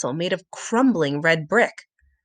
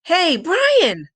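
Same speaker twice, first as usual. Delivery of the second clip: loud high call across the yard; 9 semitones higher, 5 dB louder than usual. Acted. Sam calling through the doors to Brian, loud. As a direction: call to a friend outside. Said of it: "Sam called out".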